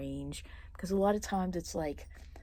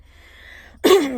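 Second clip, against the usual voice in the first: loud throat clearing; a sharp, loud clearing of the throat; 10 semitones higher, 19 dB louder than usual. Real, not acted. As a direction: clear the throat hard before going on. Clearing throat mid-rant.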